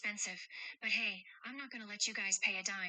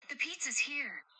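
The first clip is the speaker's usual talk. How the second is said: higher and louder, delighted the food is here; excited; 5 semitones higher, 4 dB louder than usual. Acted. Cheerful guardian announcing the pizza to the kids, excited.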